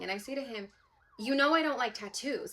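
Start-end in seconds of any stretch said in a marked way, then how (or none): 1.2-2.5 higher-pitched, sassy objection; full attitude. Retold or imitated. Daughter telling her dad off in the dream, with full attitude. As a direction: push back with plenty of attitude. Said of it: "full attitude and everything".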